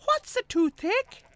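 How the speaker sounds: a cheerful tiny tractor asking kindly; little tractor Tech. A narrator's reading. Tech asks poorly horse Henry, high little-tractor voice.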